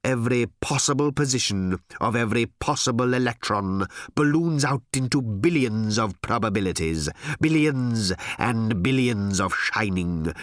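rapt, emphatic executive oratory; visionary boss. Acted. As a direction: stretch out the wonder of endless futures. Harl's visionary speech.

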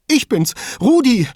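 high, loud, distraught voice; identify yourself in a panic; frightened caller. A narrator's reading. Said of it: "eine ängstlich verzerrte Männerstimme". (ängstlich und aufgelöst)

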